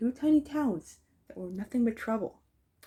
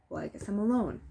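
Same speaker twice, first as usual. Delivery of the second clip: ordinary delivery, nothing marked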